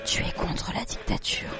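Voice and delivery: secretive whisper in the ear; slow, hushed, voiceless whisper. A narrator's reading. Boy murmurs in his classmate's ear, whispering. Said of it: "lui murmura à l'oreille".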